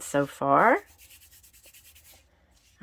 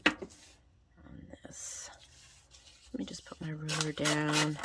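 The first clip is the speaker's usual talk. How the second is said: whispering; 9 dB quieter, slower than usual. Real, not acted. Quietly talking to herself while crafting.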